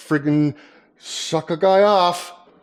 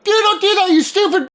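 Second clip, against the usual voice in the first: mock scolding; 13 semitones higher than usual. Scolding Tippy in a put-on voice.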